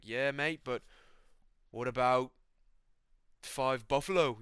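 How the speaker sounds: light attempt at an Aussie mate; Volkanovski. Camp cook offering buffalo, tentative Aussie impression. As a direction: have a go at imitating an Aussie fighter. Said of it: "I can't do a Volkanovski".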